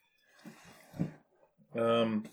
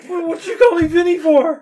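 laughing outrage; 12 dB louder than usual. Acted. Player in character, laughing.

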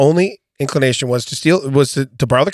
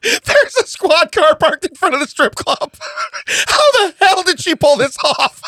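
laughing while talking. Real, not acted. Laughing-crying narration.

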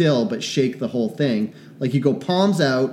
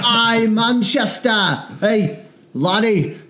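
put-on high, slow hammy British accent; mock British accent; 5 semitones higher, slower than usual. Pretend local hailing a laddie, mock British accent. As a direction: hail someone in a muddled British accent.

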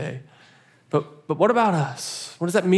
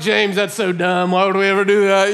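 mock disbelief; 4 semitones higher, 4 dB louder than usual. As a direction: act out thinking the idea is ridiculous. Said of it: "I'm looking at him like".

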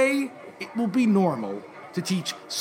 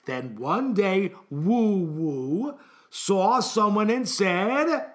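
very slow, stretched-out story line; emphatic repeat; much slower than usual. Acted. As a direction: drag each word out to cue the class. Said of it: "Let me repeat the sentence".

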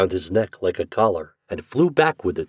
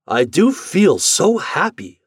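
high, slow, swooping delight; joyful storybook exclamation; happy father; 11 semitones higher, 5 dB louder, slower than usual. Acted. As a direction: relish the happiness, louder and drawn out.

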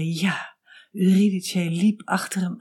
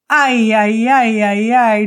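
falling wail; 3 semitones higher, 8 dB louder than usual. A narrator's reading. Narrator voices Eurydice falling back into the depths, loud wailing. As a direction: wail like someone sliding into a pit. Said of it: "met een ijzingwekkende schreeuw".

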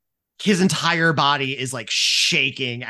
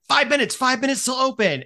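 calling out; 11 semitones higher, 3 dB louder than usual. Acted. Frat member calls countdown.

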